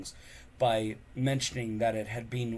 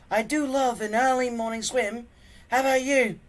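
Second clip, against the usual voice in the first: much higher, louder dry sarcasm; deadpan joke while soaked; sarcastic Fishlegs; 13 semitones higher, 8 dB louder than usual. Acted. Soaked friend joking to Hiccup, high and sarcastic.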